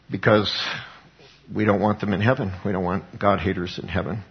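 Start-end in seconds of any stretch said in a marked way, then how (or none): none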